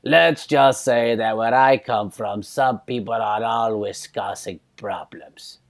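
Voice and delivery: put-on slow, knowing Croatian cab driver accent; hint darkly in a Croatian accent. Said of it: "And he goes".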